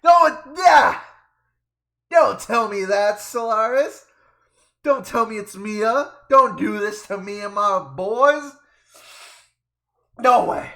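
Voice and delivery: loud, high-pitched protest; shouted disbelief. Real, not acted. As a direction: yell in dramatic disbelief. Player reacts to Mia's danger.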